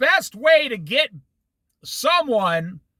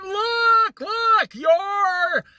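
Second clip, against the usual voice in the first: high, slow shouted accusation; mock shout; 9 semitones higher, slower than usual. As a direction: yell it like a running, pointing Pharisee. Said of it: "running towards Jesus and saying".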